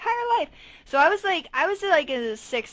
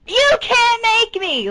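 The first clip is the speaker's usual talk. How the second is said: higher, louder, slow defiant shout; defiant childish yell; 12 semitones higher, 7 dB louder, slower than usual. Retold or imitated. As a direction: throw a tantrum at dad. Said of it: "I was flipping shit".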